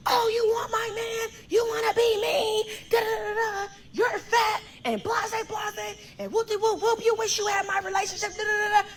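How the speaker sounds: high-pitched imitation of her yelling; mock yelling. Retelling the other woman's rant on the phone, mock yelling. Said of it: "She's, like, yelling".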